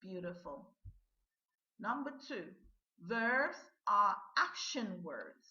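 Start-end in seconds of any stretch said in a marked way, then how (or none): 3.1-5.5 slow and loud, each word separate; slow model sentence. Shown as a demonstration. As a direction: dictate it slowly for repetition. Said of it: "listen and repeat these sentences".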